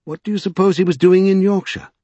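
high-pitched, puzzled wondering. A narrator's reading. Norrell puzzling over the vanished man, wondering. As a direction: muse aloud, voice lifted. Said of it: "in a tone of wonder".

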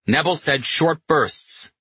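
high, slow, emphatic scolding; shouted rebuke. A narrator's reading. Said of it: "Pino shouted".

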